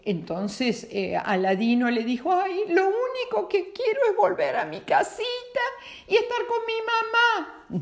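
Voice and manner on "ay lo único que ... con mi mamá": put-on very high, childlike longing; a little boy begging; pleading child voice. A narrator's reading. Aladino pleads for home. (niño suplicante, voz muy aguda)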